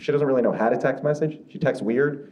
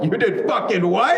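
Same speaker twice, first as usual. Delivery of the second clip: outraged disbelief; 5 semitones higher than usual. Retold or imitated. Son hearing mom added him to list, outraged. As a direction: bark it out in disbelief.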